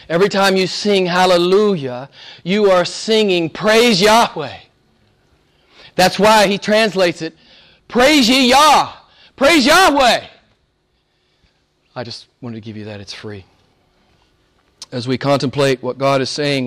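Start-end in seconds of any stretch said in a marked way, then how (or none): 3.5-10.3 high, loud, slow ringing cry; soaring cry of praise; exultant praise. Real, not acted. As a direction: shout the name in triumph.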